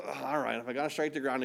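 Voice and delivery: low, soft, half-hearted grumbling agreement; lackadaisical. Acted. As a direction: act like you can't be bothered. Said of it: "he's kind of lackadaisical".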